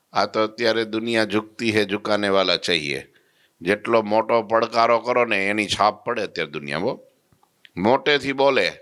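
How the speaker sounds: normal talking voice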